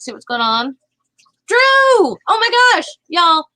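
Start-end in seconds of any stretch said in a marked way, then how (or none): 1.5-3.4 high, loud, drawn-out shout of delight; excited greeting. Real, not acted. Streamer spotting a buddy in chat, excited shout. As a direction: call out thrilled, stretching the words.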